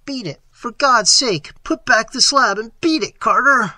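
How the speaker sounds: high, loud, desperate plea; high-pitched cry of warning to a friend; piteous cry. A narrator's reading. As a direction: cry out in urgent, pleading alarm. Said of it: "a piteous cry from Warren".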